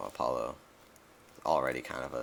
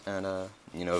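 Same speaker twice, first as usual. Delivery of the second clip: ordinary talk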